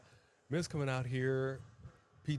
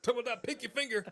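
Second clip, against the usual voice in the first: fast, high squeaky teasing; mocking high voice; 10 semitones higher, faster than usual. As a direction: tease him in a silly high voice. Keeps mocking the fingers line.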